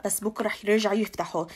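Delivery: normal talking voice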